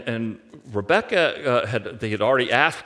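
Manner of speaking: ordinary talk, nothing marked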